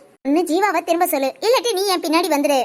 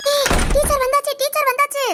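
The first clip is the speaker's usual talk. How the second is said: urgent alert; 7 semitones higher than usual. Acted.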